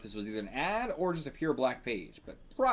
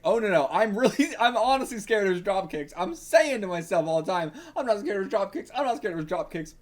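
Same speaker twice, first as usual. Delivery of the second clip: higher, louder hammed-up panic; mock terrified; 6 semitones higher, 4 dB louder than usual. Reader playing Spider-Man scared of drop kicks, mock terrified. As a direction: protest in pretend fright. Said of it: "Spider-Man is terrified of the kangaroo".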